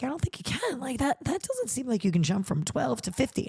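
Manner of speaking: husband's doubt. Retold or imitated. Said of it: "And my husband was like".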